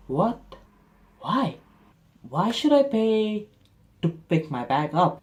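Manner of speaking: high-pitched, halting disbelief; acted baffled protest; incredulous. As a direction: question it slowly in high disbelief. Incredulous at the five euro fee.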